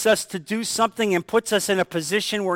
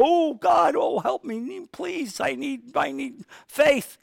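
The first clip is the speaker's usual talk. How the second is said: panicked prayer; 6 semitones higher, 5 dB quieter, slower than usual. Retold or imitated. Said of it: "all of a sudden go".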